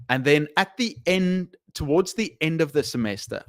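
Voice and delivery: ordinary talk, nothing marked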